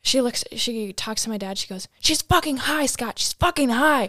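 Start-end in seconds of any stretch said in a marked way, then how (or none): 2.0-4.1 higher, louder furious accusation; retold yelling mom. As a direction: yell it to dad, outraged. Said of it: "she's, like, yelling at me".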